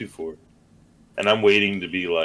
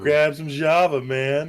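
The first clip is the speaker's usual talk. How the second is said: stoner voice; 7 semitones higher, slower than usual. Retold or imitated. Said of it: "quoting Tommy Chong line".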